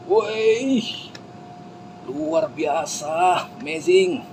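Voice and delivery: very high drawn-out cheer; euforia penonton. Acted. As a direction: cheer like an ecstatic crowd, high and stretched. (sorakan euforia bernada tinggi)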